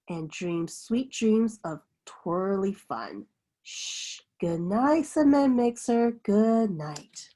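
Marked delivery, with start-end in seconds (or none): 3.6-4.2 gentle unvoiced shh; a quiet shushing breath; soft shush. A narrator's reading. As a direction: hush a sleepy child. Hushing the truck to sleep.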